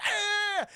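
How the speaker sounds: high, drawn-out acted scream; imitated high scream; mock scream. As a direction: shriek in hammed-up fright. Character screams at the hanged man.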